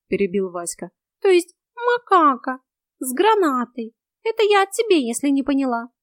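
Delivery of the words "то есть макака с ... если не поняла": high, loud cat voice; high-pitched, loud taunt; insulting cat. A narrator's reading. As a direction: jibe at her as a cat.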